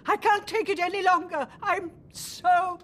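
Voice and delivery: rising anger, slowing at the end; acted anger. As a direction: let the fury spill over. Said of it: "How was that? Visual enough?".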